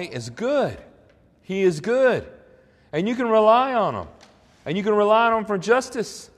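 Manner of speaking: loud, high-pitched proclamation; impassioned preaching. Real, not acted. Preacher assuring the congregation that God is good, impassioned proclamation. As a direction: preach it out with rising fervor, then ease off.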